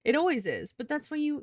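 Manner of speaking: ordinary talk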